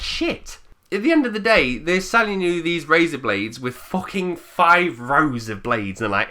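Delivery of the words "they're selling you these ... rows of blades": ordinary talk, nothing marked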